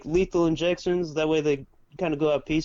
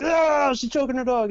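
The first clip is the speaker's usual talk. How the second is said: high-pitched mock scream of alarm; imitated mom's outcry; 8 semitones higher than usual. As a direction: cry out like mom, unable to gauge her volume. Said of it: "she can't gauge her own voice".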